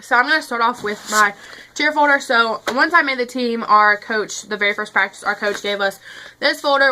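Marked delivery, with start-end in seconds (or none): none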